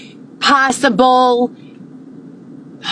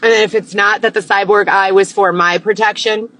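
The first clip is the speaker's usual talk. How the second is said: normal talking voice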